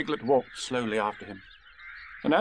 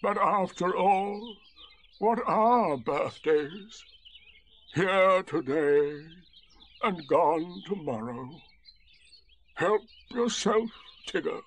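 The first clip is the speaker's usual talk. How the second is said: slow, higher, resigned donkey voice; gloomy donkey; 6 semitones higher, much slower than usual. A narrator's reading. Donkey giving up his birthday treat, slow and resigned. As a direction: philosophise in long, mournful words.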